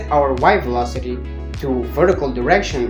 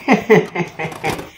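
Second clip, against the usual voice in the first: laugh; 2 semitones higher than usual. Acted. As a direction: run of quick, descending chuckles. Laugh after the acted meltdown.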